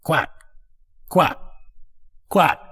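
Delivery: short, widely spaced quacks; duck quacking. Acted. Duck swimming off downstream, quacking. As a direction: let each quack stand alone.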